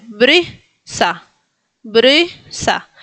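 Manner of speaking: "Brysa" with vowel midway between E and I. Shown as a demonstration. Teacher shows neither E nor I, in-between Y in "Brysa". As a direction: stretch "Brysa" in two parts, lips in an O. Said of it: "digo algo entre medio".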